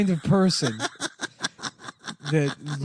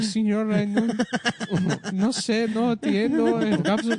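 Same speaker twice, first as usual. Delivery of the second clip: high, slow put-on Spanish accent, laughing; mock confused Mexican boy; 8 semitones higher, slower than usual. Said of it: "He's like".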